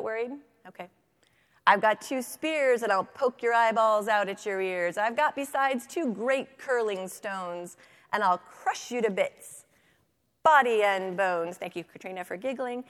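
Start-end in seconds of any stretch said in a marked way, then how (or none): 1.7-11.6 forceful, booming threat; loud billy goat. A narrator's reading. Biggest goat threatening to crush the troll, loudly. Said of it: "whose voice was as loud as the troll's".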